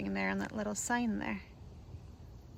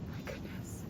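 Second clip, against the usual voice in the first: hushed, voiceless amazement; awed whisper; 13 dB quieter, slower than usual. Real, not acted. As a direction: whisper it to yourself in awe. Awed by alligator close by.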